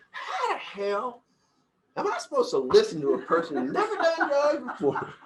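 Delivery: Southern dude. Retold or imitated. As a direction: do the Southern guy, stretching how the hell. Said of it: "He was, like, a Southern dude".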